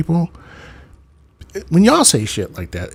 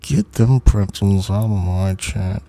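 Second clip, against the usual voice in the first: "Get them pretzels out of my chat" said through pursed lips; 3 semitones lower than usual. Acted. Streamer showing mock anger through pursed lips. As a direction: push the words out through tight lips. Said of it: "my lips are like this".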